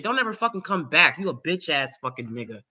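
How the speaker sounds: ordinary talk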